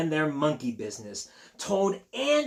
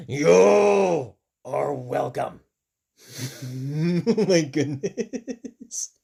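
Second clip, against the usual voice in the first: slow, louder drawn-out answer; slow Piggy reply; 3 semitones lower, 6 dB louder, much slower than usual. Acted. Piggy answering Elephant's thanks, slow and playful. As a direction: drag it out playfully.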